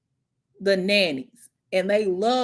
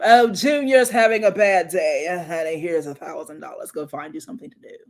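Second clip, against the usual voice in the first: mock rich parent; 6 semitones lower, 4 dB louder than usual. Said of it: "They gonna throw money at 'em".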